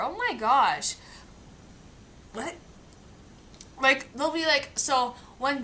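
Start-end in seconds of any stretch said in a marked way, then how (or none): none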